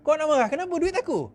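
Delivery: high-pitched indignant retort; defiant defector. Acted. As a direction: push back at the critic. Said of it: "ada di antara Y B yang melompat ni cakap macam tu". (nada tinggi, membantah angkuh)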